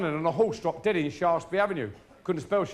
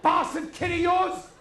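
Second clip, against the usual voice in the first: high, slow, loud curse; high shouted accusation; angry shout; 12 semitones higher, 5 dB louder, slower than usual. Acted. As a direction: spit it at the father. Mother yells at husband.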